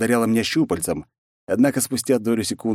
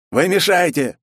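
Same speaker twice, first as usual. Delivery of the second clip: loud, high angry doctor; 10 semitones higher, 5 dB louder than usual. Acted. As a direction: snap at him angrily. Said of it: "злобно произнёс врач".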